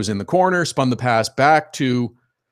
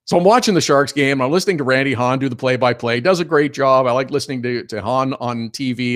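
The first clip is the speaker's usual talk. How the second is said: ordinary delivery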